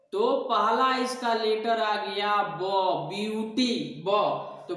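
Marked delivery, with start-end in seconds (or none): none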